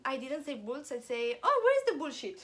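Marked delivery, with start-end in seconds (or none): 1.5-2.4 higher, louder retold question; eager retold call for toys; innocent asking. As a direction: ask it brightly, unaware of the swear.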